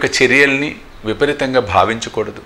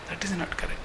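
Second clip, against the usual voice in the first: soft verdict; 7 semitones higher, 16 dB quieter, much slower than usual. Shown as a demonstration. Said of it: "repeated verdict".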